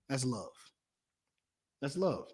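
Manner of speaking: a soft, low, settled repeat; hushed. Real, not acted. Preacher quietly driving home what love is, hushed. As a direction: let it sink in quietly.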